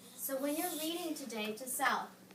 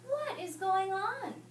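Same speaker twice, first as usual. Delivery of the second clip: higher, slow wondering farmer; puzzled farmer; 8 semitones higher, 4 dB louder, slower than usual. Acted. Storybook farmer wonders aloud, higher and slower. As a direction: ask it slowly, curious. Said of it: "said the farmer".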